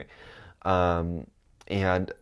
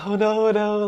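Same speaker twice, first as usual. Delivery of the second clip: flustered denial; 13 semitones higher, 6 dB louder than usual. Retold or imitated. Denying, retold, high voice.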